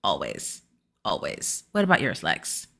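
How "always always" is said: much lower and softer; soft devoted. Real, not acted. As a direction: murmur it fondly. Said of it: "my sweet moment is Ayanna".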